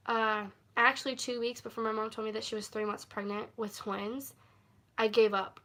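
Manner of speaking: ordinary talk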